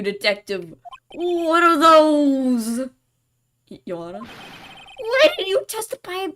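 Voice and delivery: put-on creaky elderly judge asking sternly; old judge voice.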